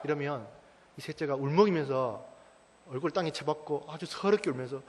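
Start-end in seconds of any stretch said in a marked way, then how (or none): none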